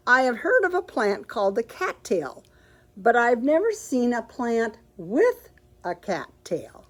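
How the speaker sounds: lively, animated picture-book reading; reading to children. Acted. Bookstore host reads Amelia Bedelia to children, animated storytelling. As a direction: read it out big for the kids at story time.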